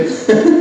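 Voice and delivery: short, high chuckle; amused laugh. Real, not acted. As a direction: chuckle at your own aside. Chuckles at his own aside.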